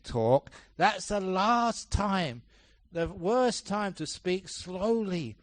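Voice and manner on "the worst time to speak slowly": usual speaking voice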